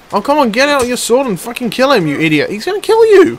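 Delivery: loud, high exasperated shout at the screen; frustrated yelling. Real, not acted. Gamer yelling at his hapless teammate to fight, loud and high. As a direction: yell at a useless teammate.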